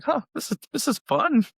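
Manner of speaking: high, amazed mock discovery; mock surprise. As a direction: act delighted to find it fun. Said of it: "And it's like".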